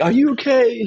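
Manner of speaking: a hint of a worried passenger; concerned stranger. Stranger at the gate checking on crying man, mildly voiced. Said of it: "it's like".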